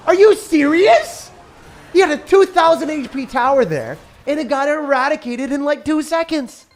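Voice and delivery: loud, high outraged disbelief; shocked disbelief. Real, not acted. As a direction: yell it in shock. Tower deleted in seconds.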